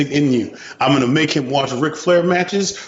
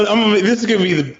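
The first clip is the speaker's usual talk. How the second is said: usual speaking voice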